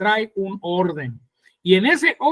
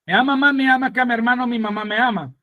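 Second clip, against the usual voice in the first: fast tongue twister; faster than usual. Shown as a demonstration. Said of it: "tongue twister rattled off fast".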